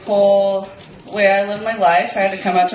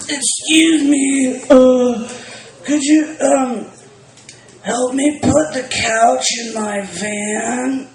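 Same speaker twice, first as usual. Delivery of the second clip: slow, creepy coaxing voice; Buffalo Bill impression; 5 semitones higher, much slower than usual. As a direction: lure them in politely, drawn out. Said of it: "that was my Buffalo Bill impression".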